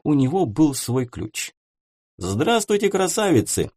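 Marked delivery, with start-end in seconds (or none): 2.2-3.7 high, bright hello; breezing in with a cheery greeting; cheerful greeting. Acted. Dmitry greeting the seamstresses, high and cheerful. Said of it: "весело поприветствовал он швей".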